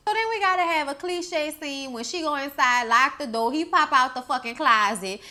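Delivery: normal talking voice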